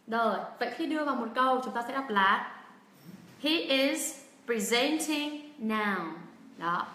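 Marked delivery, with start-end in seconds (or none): 3.4-6.1 very slow model, "presenting" as pri-ZENT-ing; stress on second syllable. Shown as a demonstration. Teacher puts the verb in a sentence, very slow pri-ZENT-ing. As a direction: spell it out slowly, weight on ZENT in "presenting". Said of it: "trọng âm sẽ rơi vào âm tiết thứ".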